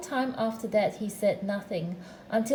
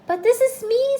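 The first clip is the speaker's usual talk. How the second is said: high, loud astonishment; startled, raised voice; very much surprised; 12 semitones higher, 9 dB louder than usual. A narrator's reading. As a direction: protest in amazement, pitched right up. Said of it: "very much surprised".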